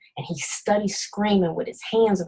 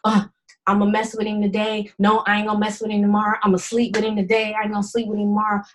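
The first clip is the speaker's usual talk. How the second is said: indecisive self; much faster than usual. Retold or imitated.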